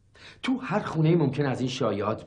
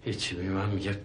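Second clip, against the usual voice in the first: normal talking voice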